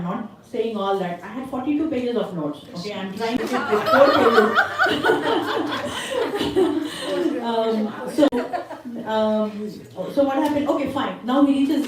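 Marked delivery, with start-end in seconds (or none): 4.5-7.4 high, sustained laughter; helpless giggling burst; laughing. Real, not acted. Storyteller amused at the long notes, laughing.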